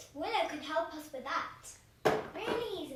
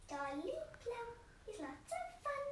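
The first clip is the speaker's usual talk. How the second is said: puppet voice; 6 semitones higher, 7 dB quieter than usual. Pretend. Dolly Plum puppet speaks.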